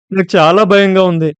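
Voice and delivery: put-on haunted girl crying out her fear; terrified cry. A narrator's reading. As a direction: panic spilling into tears. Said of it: "గట్టిగా ఏడవడం మొదలుపెట్టింది".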